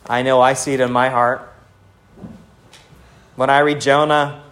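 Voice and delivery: ordinary talk, nothing marked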